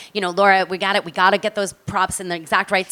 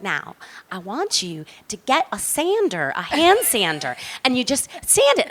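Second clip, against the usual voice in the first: put-on Southern accent; 2 semitones higher than usual. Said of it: "I wanted to make sure I was in Southern".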